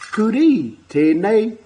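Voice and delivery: "kuri tēnei" drawn out slowly; slow, careful "kuri tēnei" for beginners; clear model phrase. Shown as a demonstration. Teacher showing children a word, slow and clear. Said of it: "really get involved".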